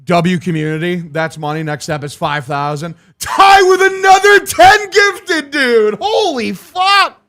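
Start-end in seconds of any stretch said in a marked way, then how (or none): 3.2-7.2 much higher, slow excited yell; real hyped shout over the donation. Real, not acted. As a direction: blow up with excitement at the gift. Huge gift of subs arrives.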